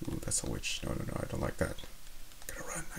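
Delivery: hushed, unvoiced whisper. Real, not acted. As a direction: whisper so the witch won't hear. Witch appears nearby in game.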